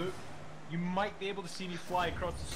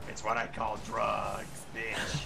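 Fallout ghoul voice; 8 semitones lower than usual. Pretend. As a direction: growl it out like a ghoul. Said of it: "sound like a freaking, uh, ghoul from Fallout four".

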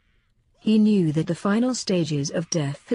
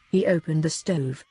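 usual speaking voice

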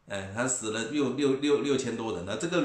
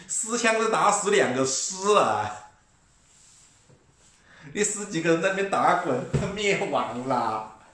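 furious rant; 8 semitones higher, 6 dB louder than usual. Real, not acted. Mocking the crying over few deaths, a high loud shout. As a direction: blast the overreaction with scorn.

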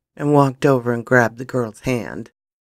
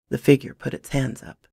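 ordinary delivery

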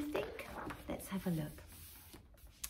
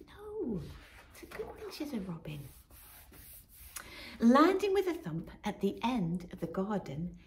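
plain reading voice

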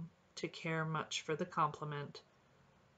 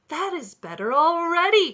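much higher, much louder delighted cry; 11 semitones higher, 12 dB louder than usual. A narrator's reading. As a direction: burst out in glee. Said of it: "cried the robin gaily".

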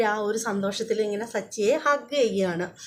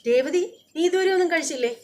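angry scolding; 7 semitones higher than usual. Retold or imitated. Sachi scolding Revathi for not eating, raised angry voice. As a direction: scold her for skipping food. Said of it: "ക്ക് ദേഷ്യമാവാണ്".

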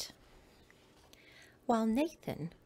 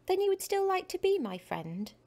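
put-on hopeful child; 9 semitones higher, 6 dB louder than usual. Nathan hopeful.

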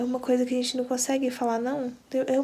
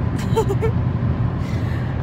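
breathy laugh; 10 semitones higher than usual. Real, not acted. Teasing husband about mother-in-law.

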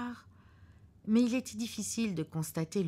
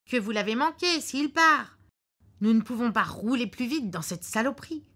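the husband's voice, louder and a bit higher, certain of himself; gruff husband; 7 semitones higher, 8 dB louder than usual. Acted. Husband in the car predicting a cancelled flight, curtly sure. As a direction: lay out the bad news like a know-it-all husband.

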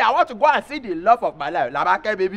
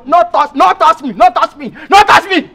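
louder, higher, rising anger; angry repeated refusal; 6 semitones higher, 7 dB louder than usual. Acted. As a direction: shove her off with the words. Angry man pushing woman off.

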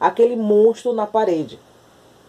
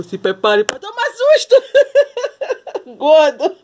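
high, excited with a laugh; 9 semitones higher, 4 dB louder than usual. Real, not acted. Teasing her fat cat, high and laughing. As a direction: tease the cat gleefully.

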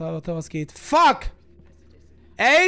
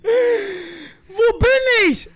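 drawn-out high, loud cry; slow frightened wail; 10 semitones higher, 6 dB louder, much slower than usual. Real, not acted. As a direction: wail it out in fear. Lost and scared.

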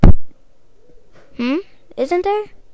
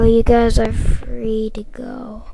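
ordinary talk, nothing marked

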